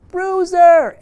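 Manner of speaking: high, loud joyful cry; delighted shout. Retold or imitated. Jerry Mitchell thrilled to see the dog, shouting. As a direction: call out the name with sheer delight.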